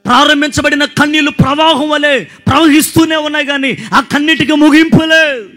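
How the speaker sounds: loud, high, fervent preaching to the crowd; full-force preaching. Acted. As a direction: preach it out, building and calling hallelujah. (బిగ్గరగా, ఉత్సాహంగా ప్రసంగం)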